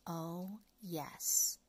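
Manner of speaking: ordinary delivery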